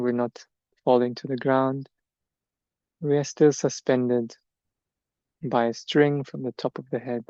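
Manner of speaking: measured meditation guidance, long silences between phrases; guided meditation. Acted. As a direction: let each body part settle before the next. Leads standing meditation.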